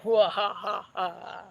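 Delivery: short wicked laugh; witch laugh. Acted. Sea witch laughing at Ariel, short wicked laugh. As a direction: chuckle with menace.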